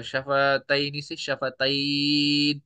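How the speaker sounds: शफतैन with a long drawn-out final ai glide; pausal recitation. Shown as a demonstration. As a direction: slowly draw out the end of शफतैन into a held n. Teacher shows pausal form.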